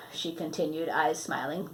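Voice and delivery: usual speaking voice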